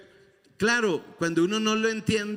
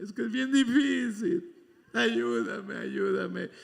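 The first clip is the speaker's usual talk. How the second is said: imitated whiny prayer; 3 semitones higher than usual. Preacher mimicking his old whining prayer to God. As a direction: beg God in a put-on weak voice. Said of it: "yo oraba así".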